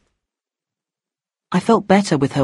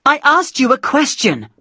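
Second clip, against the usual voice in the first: screaming; 9 semitones higher than usual. A narrator's reading. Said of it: "she screamed".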